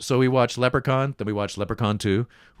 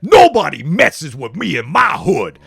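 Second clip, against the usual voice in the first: louder tough gangster impression; gangster villain; 5 semitones higher, 8 dB louder than usual. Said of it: "he's like".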